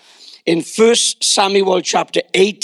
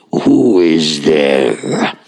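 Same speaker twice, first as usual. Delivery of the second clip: very deep, drawn-out boom; hammed-up booming voice from behind the door; forbidding father's voice; 3 dB louder, much slower than usual. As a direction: intone it slowly from far down, like a dread ruler. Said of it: "he heard a voice inside saying".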